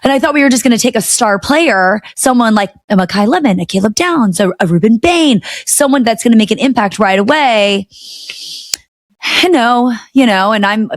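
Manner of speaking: ordinary talk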